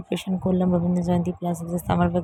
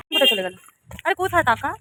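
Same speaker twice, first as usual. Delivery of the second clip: calling out; 6 semitones higher, 6 dB louder than usual. Real, not acted. Looking for friends to surprise.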